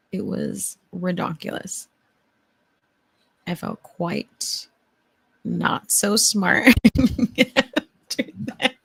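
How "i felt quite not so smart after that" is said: normal talking voice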